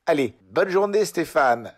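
hammed-up cheery goodbye. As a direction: sing out the goodbye. (au revoir joyeux, très aigu et fort)

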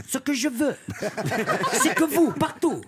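high, laughing hammed-up emperor; lightly voiced Napoleon, Corsican hint, laughing. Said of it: "Napoléon Ier".

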